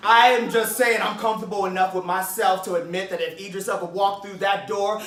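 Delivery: ordinary talk, nothing marked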